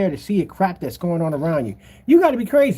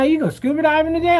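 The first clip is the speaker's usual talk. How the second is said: high, louder mocking question; curious passerby; 9 semitones higher, 3 dB louder than usual. Passerby teasing him about the ski glasses, curious. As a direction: play a nosy stranger, pitched up.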